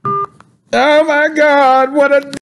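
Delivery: high-pitched mock meltdown; put-on upset. Caller too upset to stay on with Willie, mock meltdown. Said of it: "I'm so upset".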